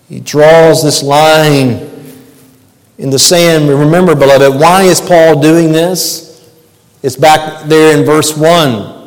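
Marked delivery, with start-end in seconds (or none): none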